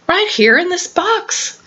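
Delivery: high, cheerful little bear voice; Little Bear happily. Acted. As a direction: chirp it out gladly like a small bear cub. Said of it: "told them happily".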